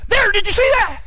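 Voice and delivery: high-pitched eager coaching call; excited calling out. Retold or imitated. Instructor calls out during lesson.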